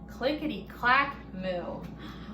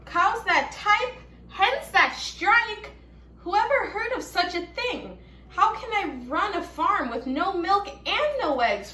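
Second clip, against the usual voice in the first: furious farmer; 8 semitones higher, 4 dB louder than usual. A narrator's reading. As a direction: rage at the absurd cows and hens. Farmer Brown was furious.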